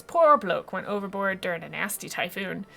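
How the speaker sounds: lightly voiced old sea dog; fish captain, mock regret. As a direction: recount a mate's loss in salty sailor style.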